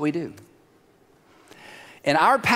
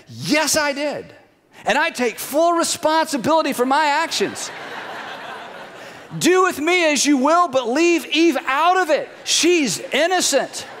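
high, declaiming hero voice; mock-noble confession; 7 semitones higher than usual. Adam owns up to God and defends Eve, mock-noble. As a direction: proclaim it grandly, pitched well up.

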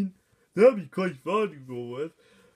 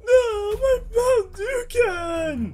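high, drawn-out cry of horror; anguished wail; 13 semitones higher, 5 dB louder, much slower than usual. Acted. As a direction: wail loudly as if a friend just died. Wailing over knocked bottle.

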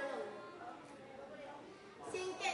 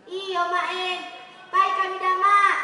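put-on loud child reply; 9 dB louder than usual. Child answering mother.

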